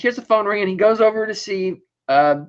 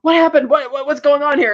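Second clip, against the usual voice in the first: raised, high-pitched demand; alarmed demand; 7 semitones higher than usual. Retold or imitated. Emmett grabbing the secretary's arm, alarmed and demanding. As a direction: grab her and insist on answers.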